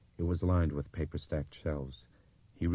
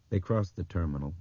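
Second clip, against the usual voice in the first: ordinary delivery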